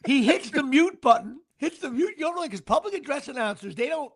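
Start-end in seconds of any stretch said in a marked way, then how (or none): none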